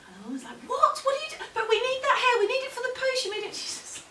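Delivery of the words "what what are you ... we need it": very high, loud, fast outcry; horrified protest. Acted. Woman seeing the hair thrown in the fire, horrified. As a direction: shriek it in panicked disbelief.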